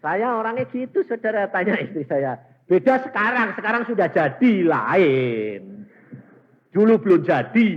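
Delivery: high, loud preacher's testimony; preaching at full force. Acted. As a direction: call it out to the congregation, high and loud. (berkhotbah lantang dengan nada tinggi)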